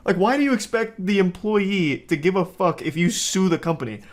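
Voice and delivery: ordinary talk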